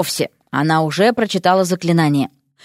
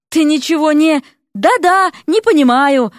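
higher, louder exasperated outburst; heated quarrel; 6 semitones higher, 4 dB louder than usual. A narrator's reading. Husband and wife arguing over understanding, heated. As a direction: cut across each other angrily.

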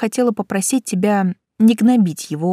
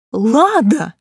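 furious chef; 3 semitones higher, 4 dB louder than usual. A narrator's reading. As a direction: boss yelling her name. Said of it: "заорал он".

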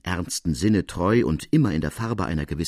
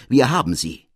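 loud, high shout of joy; jubilant cry; 4 semitones higher, 6 dB louder than usual. Acted. Larigny exulting to the listeners, loud joyful cry. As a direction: cry out in triumph. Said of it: "jauchzt Larigny auf vor Freude".

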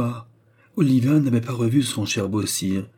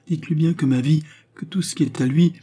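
high, fervent thanks; elated, earnest pledge; effusive gratitude; 7 semitones higher, 3 dB louder than usual. A narrator's reading. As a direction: pour out gratitude in a raised pitch. Overjoyed father pledges his gratitude.

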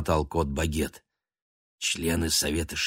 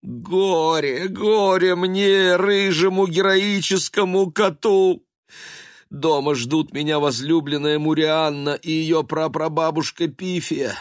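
hammed-up lament; 10 semitones higher, 5 dB louder than usual. Baguette lamenting to himself, high hammed-up wail. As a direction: wail like a tragic hero.

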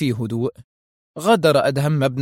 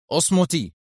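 raised-pitch stern command; menacing firmness; 4 semitones higher than usual. A narrator's reading. Masked man silencing Sonia, menacingly firm. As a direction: shut her up with firm authority. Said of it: "قاطعها المقنع في حزم مخيف".

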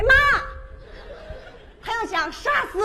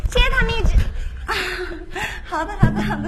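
put-on baby voice; 3 semitones higher than usual. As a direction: gush like a giggly pageant winner. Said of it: "我的娃娃音". (娃娃音)

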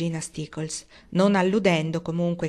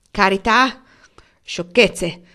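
much louder, rising scoffing bark; scornful snort; 9 dB louder, slower than usual. A narrator's reading. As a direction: snort it out with contempt. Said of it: "sbuffò lo zio Benjamin". (sbuffo sprezzante e forte)

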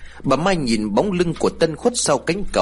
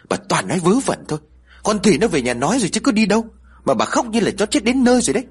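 scolding; 9 semitones higher, much faster than usual. A narrator's reading. Husband scolds wailing wife.

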